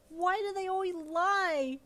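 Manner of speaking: high, loud, slow yell; angry yell. A narrator's reading. Wife yelling about lying papers to husband, loud high angry yell. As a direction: yell it at the sky in frustration. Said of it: "you yelled angrily".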